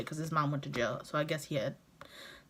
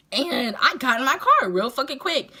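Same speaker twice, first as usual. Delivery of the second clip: normal talking voice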